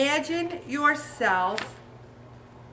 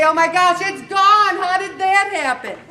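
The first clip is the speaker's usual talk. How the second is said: much higher, louder fake astonishment; mock surprise; 6 semitones higher, 8 dB louder than usual. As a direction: act shocked the cake is gone. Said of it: "And then you say".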